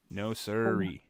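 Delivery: usual speaking voice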